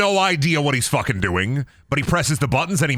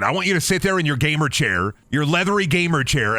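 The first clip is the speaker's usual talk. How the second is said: ordinary delivery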